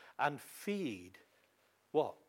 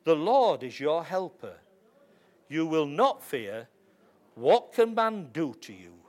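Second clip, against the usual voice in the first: confident proclamation; 8 dB louder than usual. Shown as a demonstration. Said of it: "you can confidently say".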